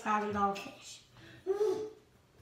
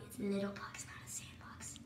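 softer sneaky whispered aside; 3 semitones lower, 11 dB quieter than usual. Acted. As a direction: whisper the secret to the listener.

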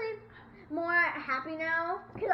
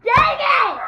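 loud annoyed yell; frustrated shout; 9 semitones higher, 15 dB louder than usual. Real, not acted. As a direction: shout it out in disgust. Losing bean boozled round.

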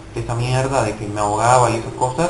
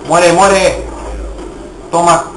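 louder yelling at the enemy on screen; fierce battle yell; 3 semitones higher, 4 dB louder, slower than usual. Real, not acted. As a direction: shout them down as you fire. Gamer shooting an enemy.

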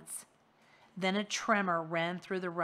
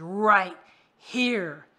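loud, heavy order; commanding monster; 11 dB louder than usual. Acted. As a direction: point and command from below.

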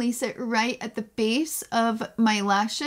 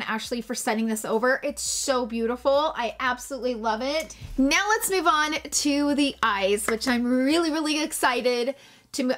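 ordinary talk

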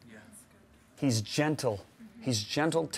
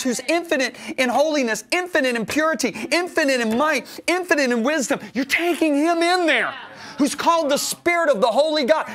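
higher and louder, hammering each infinite; full-force preaching; 9 semitones higher, 4 dB louder than usual. Acted. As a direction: preach it out, building on every infinite.

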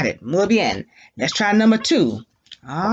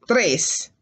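ordinary talk

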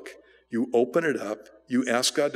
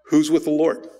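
mild yell, raised and louder; retold yell; 4 semitones higher, 5 dB louder than usual. Moses challenging the tribes to pick a side, retold yell. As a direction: call it out like a rallying challenge. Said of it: "he yells to the tribes".

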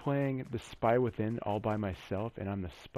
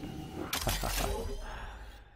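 low breathy chuckle; 9 semitones lower than usual. Real, not acted. Gamer laughing at his own pun, low breathy chuckle. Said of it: "That's some bad dad humor right there".

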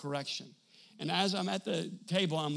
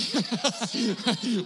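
burst of laughter; 3 semitones higher, 5 dB louder than usual. Real, not acted. Laughing at his own answer.

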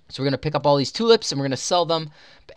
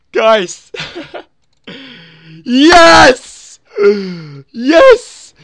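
shrieking jackpot winner; 10 semitones higher, 13 dB louder, much slower than usual. Real, not acted. Wins three days HC.

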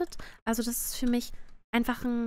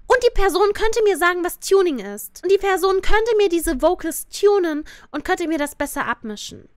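loud, raised exasperated rant; 6 semitones higher, 7 dB louder than usual. Real, not acted. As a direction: hammer the point home. Ranting about the mix.

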